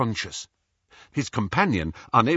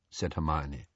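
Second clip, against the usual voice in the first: ordinary delivery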